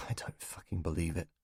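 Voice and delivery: barely audible, stunned under the breath; muttered disbelief. Acted.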